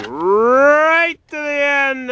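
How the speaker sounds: "right" stretched long, sliding up high and loud; RIGHT drawn out, rising. Real, not acted. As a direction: draw out "right" in a big rising swoop. Demonstrates how far key turns.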